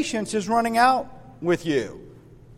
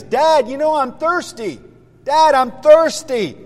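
put-on higher-pitched thirsty kid; pestering child; 6 semitones higher, 4 dB louder than usual. Johnny tells dad he is thirsty, pestering child. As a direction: nag like a child, louder on the repeat.

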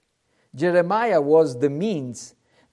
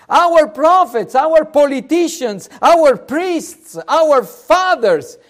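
raised, emphatic preacher's lament; fervent confession; 4 semitones higher, 4 dB louder than usual. Acted. The people admit the prophet was right, fervent confession. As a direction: cry out the people's admission, phrase by phrase.

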